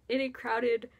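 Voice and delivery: ordinary talk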